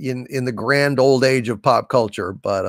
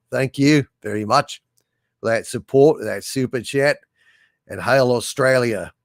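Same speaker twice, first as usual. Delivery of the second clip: put-on Australian accent; 2 semitones lower than usual. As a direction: read it out like an Aussie fan. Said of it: "Your Australian accent is not terrible".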